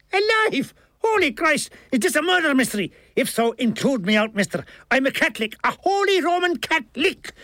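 high, panicky Irish character voice; alarmed Milligan. A narrator's reading. Milligan fears he is in a murder mystery, high alarm. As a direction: protest in comic alarm, pitched well up.

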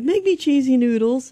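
a hint of her kid asking for food; imitating youngest child. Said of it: "It's like".

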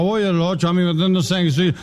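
mock tough-guy boast; boastful drunk. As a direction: brag like a would-be fighter. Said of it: "la etapa violenta".

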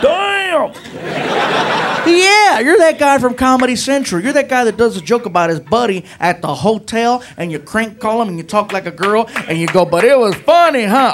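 high excited fan voice; lightly voiced eager cop; starstruck cop. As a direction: gush over the favorite joke. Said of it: "I'm looking at him like".

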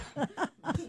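high, soft laugh; helpless laugh after laughing too hard; worn-out laughter. Real, not acted. Host spent after the comedian's set, high helpless laughter. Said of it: "my head hurts from laughing so hard".

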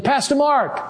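name called high across the hall; calling out. Retold or imitated. Producer calls the pastor again from the balcony, high call. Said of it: "He said".

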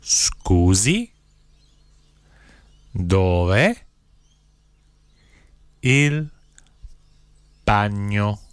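each word set apart by long pauses; one word, pause, next word; slow, word by word. Shown as a demonstration. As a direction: spell it out slowly for learners to repeat. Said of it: "then I'll say one word at a time slowly".

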